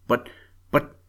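halting start, then a quick puzzled question; baffled, stuttering question; stammering. A narrator's reading. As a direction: stammer in confusion, then blurt it out. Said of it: "stammered Peter".

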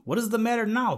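rising high and loud in alarm; startled, worried cry to the old frog; a sudden high cry of dismay. A narrator's reading. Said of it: "they cried".

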